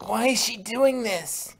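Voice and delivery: high, loud, slow filly voice asking why; a young filly's upset question, pitched high; Scootaloo voice. Acted. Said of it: "asked Scootaloo".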